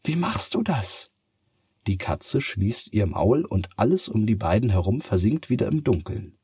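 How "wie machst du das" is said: put-on much higher girl in wonder; a child who can't believe her eyes; amazed girl. Sophia in wonder. (staunendes Mädchen)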